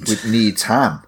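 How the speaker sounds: NEEDHAM said as two words, need-ham; full clear HAM at the end of Needham; split pronunciation. Shown as a demonstration. Friend teases about the lake's name, Needham as need-ham. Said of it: "It's not Needham".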